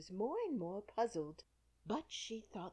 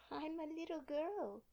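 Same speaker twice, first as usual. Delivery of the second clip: doubtful; 10 semitones higher than usual. A narrator's reading. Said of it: "rather doubtfully".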